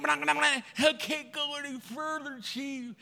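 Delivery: high, soft mock whimper; crying recruit. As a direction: whine it tearfully to the chief. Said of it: "another guy crying too".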